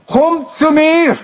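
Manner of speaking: high, drawn-out call across a distance. Acted. God to the person who went away, calling out. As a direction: call them over, stretching the words on a high pitch. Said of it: "aber er hat gerufen".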